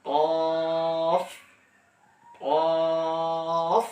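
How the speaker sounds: কফ thick and heavy from the back of the tongue; Arabic letter qaaf. Shown as a demonstration. Teacher demonstrating heavy কফ. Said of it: "মোটাভাবে আদায় হবে".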